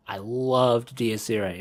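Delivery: ordinary talk, nothing marked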